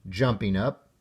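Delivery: usual speaking voice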